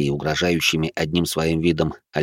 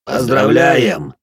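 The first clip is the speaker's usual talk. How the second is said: slow, loud ceremonial cheer; booming chorus; 3 semitones higher, 6 dB louder, much slower than usual. Acted. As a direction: boom out the congratulations. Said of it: "сказали они хором". (громкое торжественное поздравление хором)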